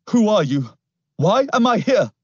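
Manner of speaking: high, loud, rising panic; frightened questions. Acted. Boy demanding answers from his captor, frightened.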